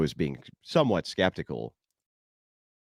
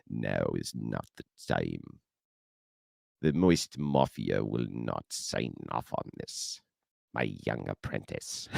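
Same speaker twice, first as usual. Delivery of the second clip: much lower, slow, sinister emperor voice; Palpatine voice; 11 semitones lower, 5 dB quieter than usual. Retold or imitated. Handlers forbidding the helicopter trip, slow low Palpatine. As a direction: croak it out like an old Sith lord. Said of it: "That's why I'm so good at the Palpatine voice".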